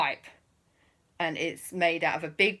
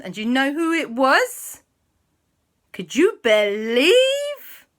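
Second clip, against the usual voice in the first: rising, louder storyteller tease; suspenseful build-up; 6 semitones higher, 5 dB louder than usual. A narrator's reading. Storyteller teasing who it was, dramatic build-up. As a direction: stretch it out, climbing high toward the reveal.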